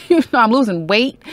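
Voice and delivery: a hammed-up fed-up complaint; mock frustrated rant. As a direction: vent like they drive you up the wall. End of same frustrated quote.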